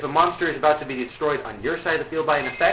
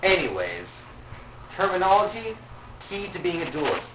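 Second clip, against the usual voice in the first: ordinary talk, nothing marked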